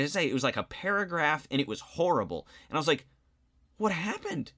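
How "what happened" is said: high, baffled "what happened"; a teacher caught off guard, pitched well up; surprised. Retold or imitated. Teacher asking a debate star about his short essay, startled and high.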